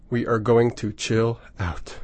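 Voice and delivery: slow, soft and soothing; slow calming voice. Acted. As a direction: ease the listener down gently. DJ easing into calm set.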